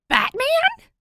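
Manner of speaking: shrieked high, loud Batman; stunned shout on hearing the secret; shocked. Acted. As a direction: squeal it in pure disbelief. Disbelieving shout at reveal.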